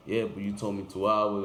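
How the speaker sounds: loud, indignant retold complaint; mock angry friend. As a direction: push back hard like the annoyed friend. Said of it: "He, he lost it".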